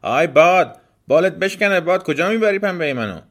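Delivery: high, loud cry after the wind; indignant high-pitched yell; angry shout. Acted. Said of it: "دختر داد زد".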